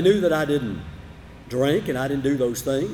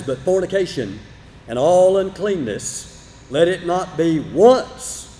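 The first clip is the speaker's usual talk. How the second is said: slow, weighty stress on "once" and "uncleanness"; emphatic scripture reading. Shown as a demonstration. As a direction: lean hard on "once", stretch "all uncleanness". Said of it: "quote it again with a little bit different emphasis".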